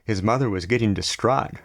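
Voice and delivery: ordinary delivery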